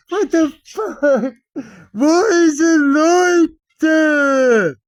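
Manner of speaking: very high, loud, long-drawn wail; hammed-up howl, stretch every word out; mock anguished howl. Human whose only cake broke, mock anguished howl.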